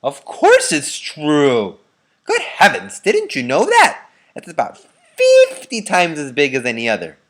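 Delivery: put-on old voices insisting it's obvious; loud indignant elderly voice; excited old grandparent. A narrator's reading. Grandparents exclaim.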